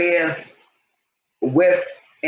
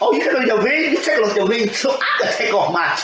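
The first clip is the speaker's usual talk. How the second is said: higher, sing-song taunt; a hammed-up high sing-song jab; mocking high taunt; 8 semitones higher than usual. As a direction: taunt him in a lilting voice.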